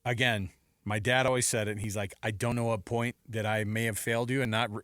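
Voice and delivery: normal talking voice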